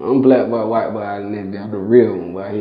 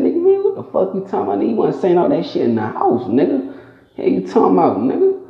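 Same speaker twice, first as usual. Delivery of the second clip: fast, much higher indignant retort; retold comeback; 9 semitones higher, faster than usual. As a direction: fire it back fast and high, calling his bluff. Narrator snaps back at the taunter.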